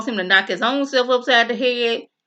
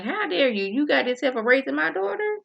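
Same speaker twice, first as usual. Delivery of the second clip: indignant mother; 6 semitones higher than usual. Retold or imitated. Mother glares at her husband.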